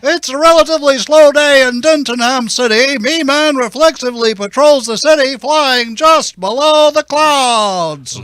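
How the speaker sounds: loud, fast announcer narration; cartoon announcer.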